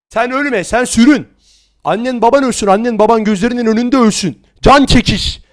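loud furious cursing. Real, not acted. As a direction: spit the curses out.

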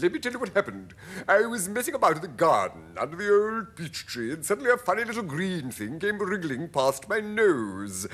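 animated, high creature voice; centipede voice. A narrator's reading. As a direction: relish the tale as a showy centipede. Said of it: "the centipede said".

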